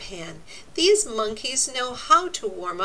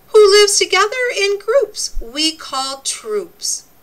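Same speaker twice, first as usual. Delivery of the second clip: excited riddle; 10 semitones higher, 8 dB louder than usual. Acted. Reading riddle aloud to kids.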